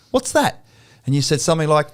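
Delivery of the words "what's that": high, squeaky mock-puzzled "what's that"; puzzled high question. Retelling his puzzled reaction to a client, high and incredulous. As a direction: pitch it way up like you can't believe it.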